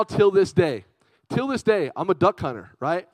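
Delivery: ordinary talk, nothing marked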